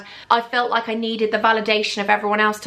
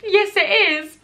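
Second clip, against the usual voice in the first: high, drawn-out mock contradiction; insistent retort; 9 semitones higher, slower than usual. Shop girl contradicts the visiting manager about her room, mock insistent.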